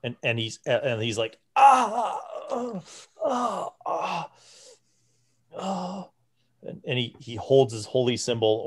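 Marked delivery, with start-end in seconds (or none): 1.6-6.1 high strained cries of pain, fading. Acted. Frail priest lifted by the party, high pained cries. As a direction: cry out as brittle joints crack. Joints crack as he's lifted.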